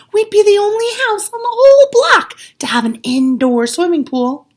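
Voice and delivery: high, loud rush of excitement; can't contain the excitement; thrilled. A narrator's reading. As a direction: marvel at the idea. Narrator thrilled at idea.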